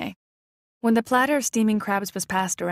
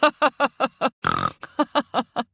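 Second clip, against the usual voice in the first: uncle's loud laugh; 4 semitones higher, 3 dB louder than usual. A narrator's reading. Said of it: "He laughed loudly".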